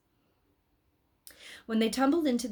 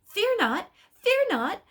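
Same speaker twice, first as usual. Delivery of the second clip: high, loud, frantic cry of fear not; excited shout; 13 semitones higher, 9 dB louder, slower than usual. Acted. Angel Imperial frightening the rider, high excited shout. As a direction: buzz like an over-excited bumblebee. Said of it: "buzzed around him like an excited bumblebee".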